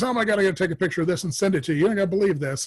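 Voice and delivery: excited retelling, quick and high; retold excitement. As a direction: imitate a friend rushing out astonished news. Retold friend excited by the match.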